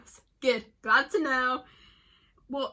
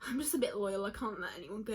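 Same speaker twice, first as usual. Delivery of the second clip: ordinary talk, nothing marked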